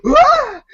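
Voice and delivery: sharp high mock gasp; class gasps. Retelling South Park, whole class gasping in shock, high. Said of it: "the whole class gasps".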